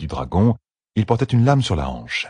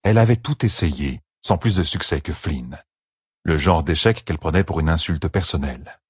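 ordinary delivery, nothing marked

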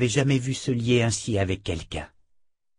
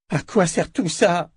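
higher, anguished cry through sobs; sobbing cry; 9 semitones higher, 3 dB louder than usual. A narrator's reading. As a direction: cry out in despair between sobs. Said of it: "cria-t-elle entre deux sanglots".

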